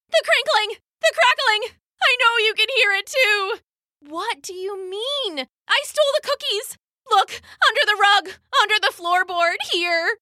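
put-on high, urgent confessing child; frantic child. Guilty child confessing the stolen cookies to her moms. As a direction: blurt it all out at once.

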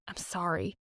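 soft, barely voiced sorry; whispered apology. A narrator's reading. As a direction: breathe the apology quietly. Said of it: "apologetically and whispered".

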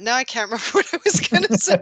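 laughing through the words; embarrassed giggly laugh while talking. Real, not acted. Laughs at forgetting her point.

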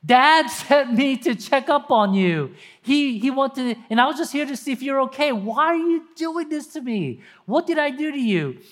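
high, hurt, bewildered pleading; confused pleading. Acted. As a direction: plead like a betrayed kid. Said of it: "he's so confused".

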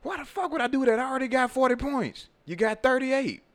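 squeaky high mocking voice. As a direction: pitch way up, taunting. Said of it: "and he was like".

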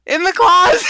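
shouting back. Pretend. Answering the imagined callers.

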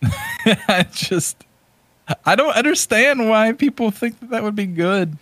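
high, laughing through the words; can't believe anyone liked it, laughing; laughing disbelief. Real, not acted. Cohost laughing at a bad movie's fans, laughing.

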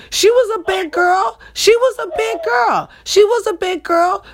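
high, climbing emphatic insistence; emphatic repetition. Real, not acted. Teller insists to her audience the woman was big, pitched high. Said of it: "listen, mind you".

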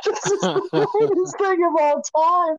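high-pitched, laughing delight; dying laughing, retold. Caterer reacting to a funeral video, laughing high. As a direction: laugh through it, voice way up. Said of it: "and I'm dying laughing".

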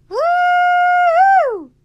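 very high, very loud whoop; whooping scream. Acted. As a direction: holler like a wild driver. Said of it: "somebody screamed".

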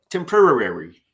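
put-on loud "Temporary" as a kid's tempura; "Temporary" like a five-year-old; childlike "Temporary". Shown as a demonstration. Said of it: "way a five-year-old would say tempura".

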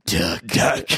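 low, gravel-free Batman growl. Pretend. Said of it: "[name]'s on his way of becoming the new Batman".

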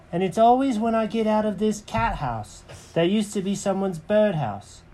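Jenkins the cat. Acted. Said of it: "And Jenkins said".

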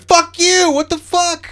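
very high, loud yell; kid cursing. Retold or imitated. Ten-year-old cursing the referee, very high and loud. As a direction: shriek it at the ref. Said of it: "I'm like".

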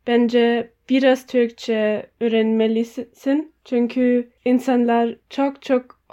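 halting non-native Turkish; learner's Turkish with hesitations; foreigner broken turkish. Real, not acted. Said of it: "benim Türkçem çok iyi değil".

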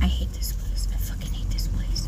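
low-volume, near-whispered grumble; disgusted muttering. Real, not acted. Driver hunting an apartment number, disgusted muttering. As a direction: mutter it bitterly under your breath. Muttering while lost in complex.

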